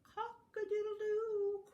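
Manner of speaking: high, loud crowing; high-pitched ringing cock-a-doodle-doo; rooster crow. Acted. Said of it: "crowed the rooster".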